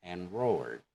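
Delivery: ordinary talk, nothing marked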